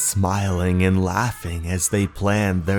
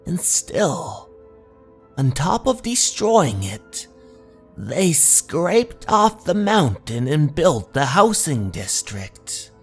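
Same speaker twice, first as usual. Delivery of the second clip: mysterious local stranger; 5 semitones higher than usual. Acted. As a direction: tell the local legend with eerie, lifted intonation. Stranger goes on telling local legend.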